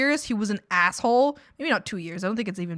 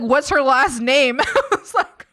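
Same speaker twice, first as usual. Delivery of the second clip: laughing; 6 semitones higher, 8 dB louder than usual. Real, not acted. Interrogating brother, laughing.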